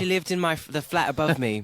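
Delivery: a jokey, badly done posh Brit; bad British accent. Guest jokes about the flat in bad British accent. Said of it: "that's the worst accent ever".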